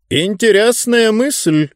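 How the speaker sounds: slow, high relishing tone; pompous ruler growing animated; perked-up interest. A narrator's reading. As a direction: savour the idea with sudden interest. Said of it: "оживился мистер Спикер".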